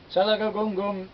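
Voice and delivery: loud, high chanted spell word; ringing incantation; magic word. Acted. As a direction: intone the closing spell. Magic word shuts the room.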